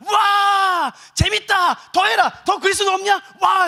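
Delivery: high, loud bloodthirsty spectator yells; cheering Roman crowd. Acted. Roman citizens rising in the arena, cheering wildly. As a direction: whip up a jeering arena mob. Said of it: "로마 시민들이 일어납니다".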